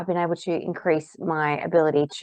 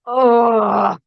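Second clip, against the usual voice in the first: loud high groan of frustration; a short loud disgusted ugh; frustrated ugh; 6 semitones higher, 9 dB louder than usual. Shown as a demonstration. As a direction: let out a fed-up groan. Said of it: "even though I feel".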